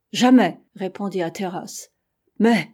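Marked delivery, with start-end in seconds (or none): 0.1-0.6 loud, emphatic narrated refusal; firm refusal. A narrator's reading. Hatteras refusing to burn the ship, firm refusal. Captain flatly refuses burning the boats. 2.4-2.7 louder one-word protest; raised voice. A narrator's reading. Several sailors objecting to Hatteras, raised voice. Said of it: "en élevant la voix".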